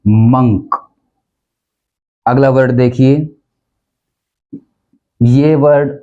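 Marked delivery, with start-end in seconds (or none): 0.1-0.7 मंक as "munk"; मंक rhyming with "sunk"; correct pronunciation. Shown as a demonstration. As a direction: say मंक with a short "uh". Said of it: "जिसका pronunciation है".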